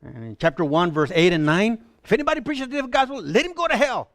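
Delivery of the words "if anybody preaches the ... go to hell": high-pitched, rapid condemnation; hard, stern. Acted. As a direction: pronounce a stern curse, fast and high. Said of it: "he was hard".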